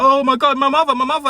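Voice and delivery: high-pitched upset cry, acted out. Retelling how Teddy turns around and gets upset, mock-upset high cry. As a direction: mimic him getting upset, pitch leaping up. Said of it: "he gets upset, he's like".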